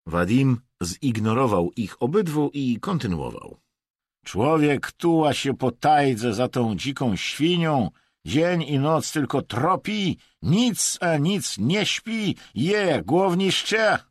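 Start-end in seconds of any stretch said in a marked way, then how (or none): 4.2-14.0 high, slow, loud grumbling; aggrieved tirade. A narrator's reading. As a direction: build a drawn-out, worked-up complaint. Hunter complains loudly to companions. (głośne, przeciągłe narzekanie)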